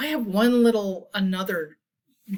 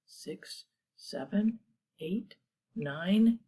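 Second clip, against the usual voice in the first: usual speaking voice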